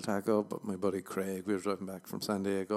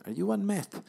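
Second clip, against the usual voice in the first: high, loud squeaky question; cheerfully blunt date query; mock blunt question; 12 semitones higher, 6 dB louder than usual. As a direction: chirp it like a bright date question.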